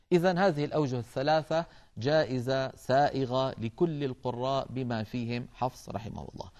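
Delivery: usual speaking voice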